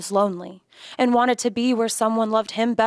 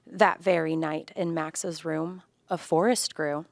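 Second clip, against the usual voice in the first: plain reading voice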